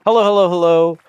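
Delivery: excited greeting. Real, not acted. Greeting raiders.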